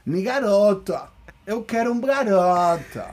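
put-on playful Brazilian Portuguese accent. Joking with the co-host about wanting a garoto, exaggerated Brazilian accent. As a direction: lean hard into a slow, hammed-up Brazilian accent.

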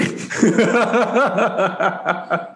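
high, long giggling fit; helpless laughter. Real, not acted. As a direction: burst out laughing at your own punchline. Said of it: "let me compose myself".

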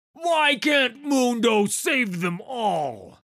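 slow, high, loud anguished roar; Mundo screaming to the sky. Acted. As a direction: bellow it at the heavens. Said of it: "he screamed to the sky".

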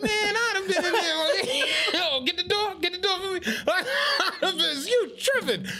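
high, excited put-on voice with laughs; hammed-up excited self. Imitating himself grabbing the bottles, high and laughing. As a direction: ham it up, eager and laughing. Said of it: "And I'm like".

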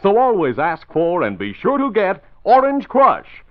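bright, eager radio ad read; chipper 1950s ad. Acted. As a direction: sell it with cheerful pep. Announcer reads an Orange Crush ad.

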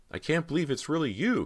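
overwhelmed at finding her lost sister; excited cry. Acted. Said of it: "Cody cried".

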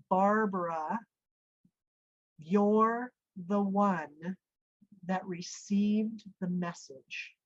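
slow, solemn voice with long pauses; big voice. Retold or imitated. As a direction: intone it like a divine command, word by word. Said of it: "the voice from the living room".

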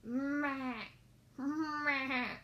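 short wobbly goat call; storybook goat noise; goat bleat. Acted. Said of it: "said the goat".

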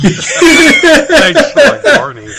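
very high, loud, slow, laughing; cartoon voice. Cohost joking about Barney in a squeaky cartoon voice. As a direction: squeal it like a kids' TV character. Mock kids' show voice.

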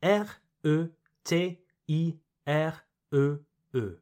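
evenly spaced French letter names, one at a time; spelled out. Shown as a demonstration. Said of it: "Écrit".

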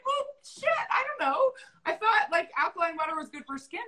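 high, startled, flustered voice; high panicked confession; mock flustered squeak. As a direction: squeak it out like you've been caught out. Caught out, retelling own reaction.

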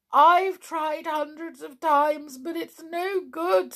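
high, thin toy clown voice; Clockwork Clown voice. Acted. Clown answering Tiptoe from his bed, high toy voice. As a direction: play the sad little clockwork clown.

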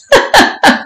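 high, airy giggle; taunting laugh. Acted. Mistress mocking her servant, high giggle. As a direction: laugh lightly at his predicament.

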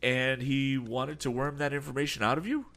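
put-on a touch of excitement in young Tom's question; excited. A narrator's reading. Tom, alarmed about Berg, asks the captain with mild excitement. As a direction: ask it keen and worked up. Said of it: "inquired the youth excitedly".

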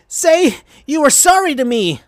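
high, loud demanding shout. Acted. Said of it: "shouted Sonic at Amy".

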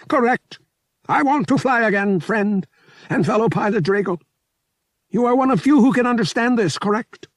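a little alien pilot pleading to fly again, pitched high; earnest high alien voice asking a fellow pilot. A narrator's reading.

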